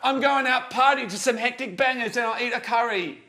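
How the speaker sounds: hammed-up mock English accent. Host refusing to stop, in an exaggerated English accent. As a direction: push on with a bad English accent. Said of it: "Can't I do an English accent".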